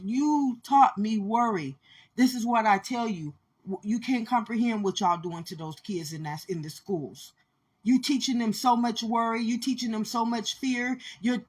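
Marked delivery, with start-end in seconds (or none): none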